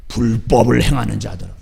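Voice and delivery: deep voice of condemnation; stern judge voice. Retold or imitated. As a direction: pronounce it as a solemn judgment. Jesus's judgment voiced.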